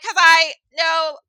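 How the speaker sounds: high, loud flustered insistence; hammed-up defensive answer; defensive protest. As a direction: blurt it loudly, then backpedal. Acted flustered answer to manager.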